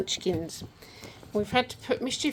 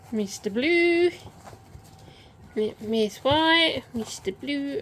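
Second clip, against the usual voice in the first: calling puppies; 5 semitones higher, 5 dB louder, slower than usual. Real, not acted.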